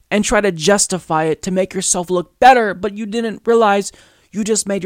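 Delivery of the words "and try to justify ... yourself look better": normal talking voice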